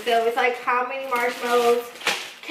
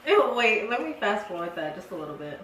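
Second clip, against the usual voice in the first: normal talking voice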